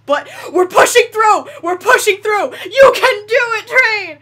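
high, loud, urgent encouragement; root for the train like a stadium fan; frantic cheering. Real, not acted.

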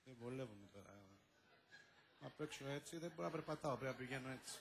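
hushed backstage grumble; hushed aside. Acted. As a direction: mutter it low so the audience won't hear. Whispered aside while hiding.

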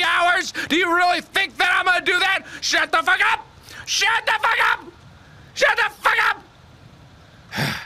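very high-pitched furious rant; high, exasperated shouting; angry outburst. Acted. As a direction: yell it way up high, fed up. Angry outburst after long wait.

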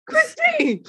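very high, laughing call of a name; laughing exclamation. Retold or imitated. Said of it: "I would be like".

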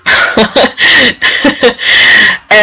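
amused burst of laughter; laughing. Real, not acted. Teacher tickled by a player's odd word, laughing. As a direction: break into a fit of giggles.

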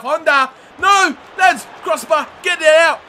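high, loud bursts of alarm; real match-play panic; panicked shouting. Real, not acted. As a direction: yell urgent orders to the defence. Defensive scare near own goal.

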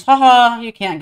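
taunting laugh. Real, not acted. Taunts monster after escaping.

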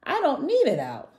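high, loud sulky protest; hammed-up high-pitched sulk. A narrator's reading. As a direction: protest like a stubborn little dinosaur. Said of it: "sulked Tiny".

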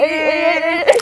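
excited group shout; shouting hey. Real, not acted. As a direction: call it out loud.

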